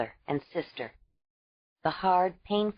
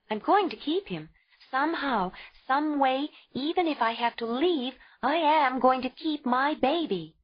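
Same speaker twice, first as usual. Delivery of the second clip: higher, insistent and proud; defiant young mother; 8 semitones higher than usual. A narrator's reading. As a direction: fight for the child. Ayla vowing.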